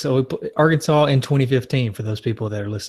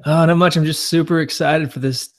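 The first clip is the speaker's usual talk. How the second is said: higher, louder hyped host; fake excitement; 4 semitones higher, 4 dB louder than usual. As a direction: sell it like a promo. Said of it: "I'll do the Hey Justin here".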